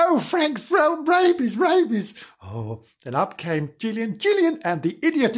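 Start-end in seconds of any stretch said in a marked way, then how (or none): 0.0-2.1 high-pitched, squealing mock panic; excitable high cry of alarm; panicked alarm. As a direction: raise the alarm, hammed-up and high. Rosie raises the alarm.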